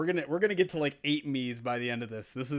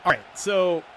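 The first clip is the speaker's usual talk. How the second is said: louder, brisk sportscaster call of the kickoff return; play-by-play commentary; 4 dB louder than usual. Acted. Streamer calling the Madden sim to viewers, announcer style.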